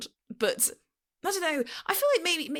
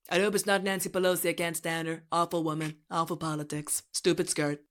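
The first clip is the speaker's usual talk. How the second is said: monotone synthetic presidential impression; deadpan robotic boasting; Trump. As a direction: parody politician in an even, AI-like delivery. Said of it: "sounds like a Trump A I voice".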